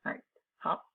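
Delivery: usual speaking voice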